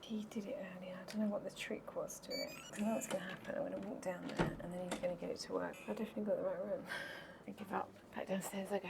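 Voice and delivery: very soft hushed voice; whispering. Real, not acted. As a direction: keep it hushed in the hallway. Late-night hotel corridor, hushed.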